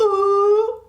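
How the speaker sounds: put-on high drawn-out kids' "ooh"; mock crowd "ooh". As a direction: imitate classmates egging on a fight. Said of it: "we just did the".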